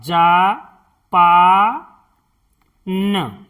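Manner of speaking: Japan drawn out slowly as जा-पा-न; slow syllable-by-syllable. Shown as a demonstration. Teacher sounding out Japan to show how to write it, slowly. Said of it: "इस तरह से".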